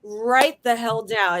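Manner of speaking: ordinary delivery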